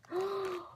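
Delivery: a sharp thrilled intake of breath; excited gasp. Real, not acted. Opening the package.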